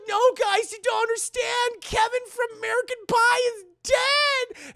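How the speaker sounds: high, loud frantic cry; hammed-up hysterical warning; mock panic. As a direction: plead to be believed, building to a wail. Frightened woman warns disbelieving friends.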